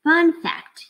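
ordinary delivery

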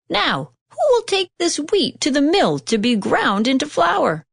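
high, eager hen's question; hen crying out. A narrator's reading. Hen asking the lazy animals for help, high call. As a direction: call out brightly as the little hen. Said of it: "the little red hen asked".

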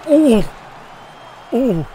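sudden high loud oh; surprised shout. Real, not acted. Player reacting to a huge hit, high loud oh. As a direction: yelp at the hit. Big hit in hockey game.

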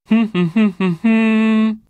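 a warm amused laugh at the lass; hearty chuckle. Acted. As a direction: chuckle as if she's being a bit naive. Old man chuckles at her.

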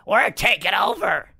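rough, strained imitation of a wrestler's yell; mock scream. Podcaster retelling Stevie Richards in cut-off tank top, mock scream. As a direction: scream it like a wrestler storming the ring.

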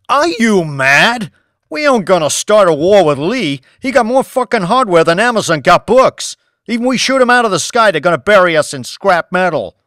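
loud, high, furious leader voice; angry president. Acted. President yelling at Banks over starting a war, furious. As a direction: shout him down as an outraged head of state.

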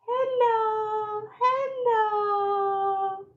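high, drawn-out pretend baby hello; baby greeting. Baby shows her hands and says hello, high voice.

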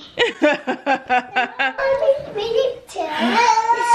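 high, breathy burst of laughter; delighted laugh. Real, not acted. Mom laughs at child.

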